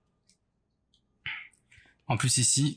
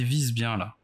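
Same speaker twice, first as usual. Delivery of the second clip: usual speaking voice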